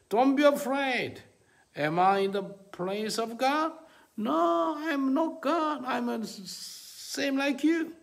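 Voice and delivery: loud, high-pitched cry as Joseph; impassioned exclamation. Acted. Joseph answers his bowed-down brothers, loud impassioned cry. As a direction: proclaim it at full force, drawing words out.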